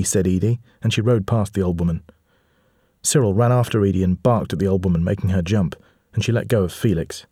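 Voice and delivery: ordinary delivery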